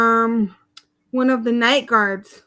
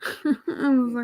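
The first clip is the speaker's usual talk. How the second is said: laughing; 4 dB quieter, slower than usual. Real, not acted. Laughs while retelling.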